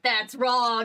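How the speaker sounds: mock scolding. Imitating Aussies in chat, mock scolding. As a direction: call out the mistake, imitating chat. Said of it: "all the Aussies in chat were like".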